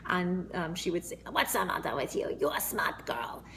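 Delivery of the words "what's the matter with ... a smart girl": coarse, whispered imitation of a European grandmother's accent; grandma's European accent. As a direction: imitate a European grandma who smoked, hushed and rough. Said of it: "she had a very lovely accent".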